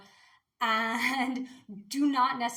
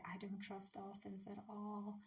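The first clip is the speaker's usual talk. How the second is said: hushed aside; 2 semitones lower, 17 dB quieter than usual. Real, not acted. Confiding distrust of dolphins to viewers, in a hushed aside. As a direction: drop it right down under your breath.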